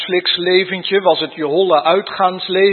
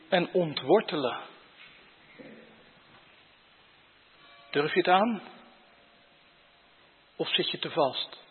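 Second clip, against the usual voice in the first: much softer, intimate sermon voice; hushed preaching; 3 semitones lower, 14 dB quieter than usual. Acted. Preacher quietly probing the congregation, hushed. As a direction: lean in and drop the voice to a hush. Preacher drops low and soft.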